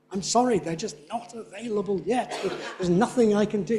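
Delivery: "not available yet" with a heavy beat on every word, half laughing; mock-insistent apology, punching NOT, AVAILABLE and YET; over-emphatic. Teacher acting an apology about unavailable booking, very emphatic. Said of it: "It's very emphatic".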